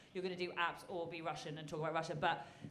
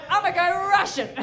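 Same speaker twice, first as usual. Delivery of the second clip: much louder, higher mock battle cry; mock defiant declaration; 12 semitones higher, 15 dB louder than usual. Judge imitating Helen's bold choice, loud mock defiance. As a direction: belt it out through laughter.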